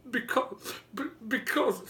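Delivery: put-on high, stammering little boy; a child stuttering through tears. A narrator's reading. Little boy stammers answering the giant, high.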